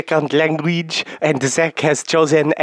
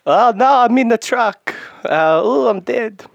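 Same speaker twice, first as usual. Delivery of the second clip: hammed-up death scene; 8 semitones higher, 3 dB louder than usual. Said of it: "doing, um, Inception Marion right now".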